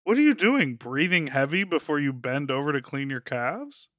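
usual speaking voice

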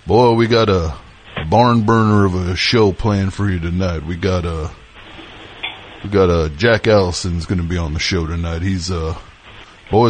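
put-on host the show like a folksy country boy; Southern country podcast host; down-home country host. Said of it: "down-home country guy to be in the mix".

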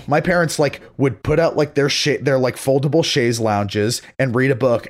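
ordinary delivery

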